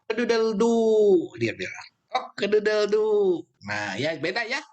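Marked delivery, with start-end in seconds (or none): 0.1-1.3 higher, slow cock-a-doodle-doo; rooster crow. Shown as a demonstration. Teacher demonstrates the English rooster crow to the class. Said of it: "orang Inggris bilangnya". 2.1-3.4 rooster crow. Shown as a demonstration. Teacher repeats the English rooster crow to the class. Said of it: "orang Inggris bilangnya".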